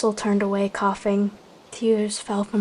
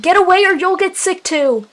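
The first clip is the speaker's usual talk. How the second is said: higher, louder urgent shout; yelled warning; 9 semitones higher, 6 dB louder than usual. Acted. Leader warning clan about the sickness, shouting urgently. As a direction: keep yelling them away.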